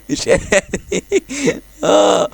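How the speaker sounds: high helpless laughter; laughing. Real, not acted. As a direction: laugh so hard you can barely talk. Laughing at the game.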